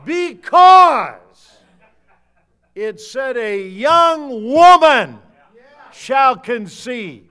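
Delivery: booming, slow shouted preaching; preaching at full force. Acted. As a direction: thunder it from the pulpit. Fiery preaching about the RSV.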